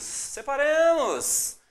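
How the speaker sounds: "separemos" stretched slowly, hissing the s; slow drawn-out. Shown as a demonstration. Teacher drawing out the word before syllable split, slow.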